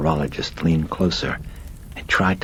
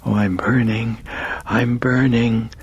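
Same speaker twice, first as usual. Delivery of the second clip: pained, higher and slow on the last burning; moaning in pain; 6 semitones higher than usual. A narrator's reading. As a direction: drag out the burning in agony. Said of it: "Regan was moaning".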